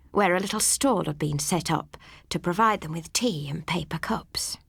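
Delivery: ordinary delivery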